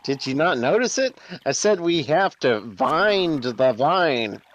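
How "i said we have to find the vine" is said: "vind" said with a V, slow; pun on vind. Shown as a demonstration. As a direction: stress "vind" with a buzzing V sound. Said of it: "instead of find".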